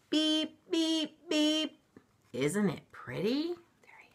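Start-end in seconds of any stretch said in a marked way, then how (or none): 0.1-1.8 high, even toy-horn beeps; truck horn beep. Acted. Truck horn voiced in story.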